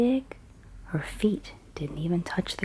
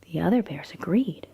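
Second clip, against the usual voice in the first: whispered bedtime story. A narrator's reading. Storyteller reading Goldilocks at bedtime, in a hushed whisper. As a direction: whisper the tale as if a child is drifting off.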